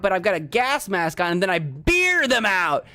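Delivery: normal talking voice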